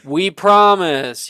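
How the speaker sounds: loud, slow chorus of friends; eager promise. Acted. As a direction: promise it with a wide grin. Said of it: "You can hear Wilfred and Rin speaking".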